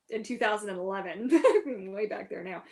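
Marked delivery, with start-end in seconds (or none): none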